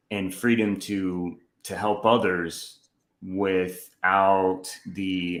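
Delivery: slow, deliberate words while searching for the right phrase. Real, not acted. Hunting for a word while telling Joseph the lesson, slowed down. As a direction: stretch the words out as you think. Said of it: "so I'm slowing down my how I'm talking".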